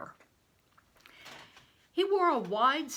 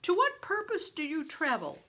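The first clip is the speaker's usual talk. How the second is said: big stranger; 8 semitones higher, 6 dB louder than usual. A narrator's reading. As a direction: play the huge man, loud and hearty.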